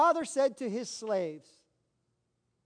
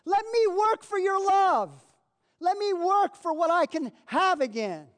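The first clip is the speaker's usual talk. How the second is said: pleading preaching; 6 semitones higher, 5 dB louder than usual. Acted. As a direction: cry out the son's plea to God with full force. Son pleading to the father.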